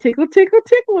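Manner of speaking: high singsong tickle tickle; high mock singsong; playful teasing. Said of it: "And it was like".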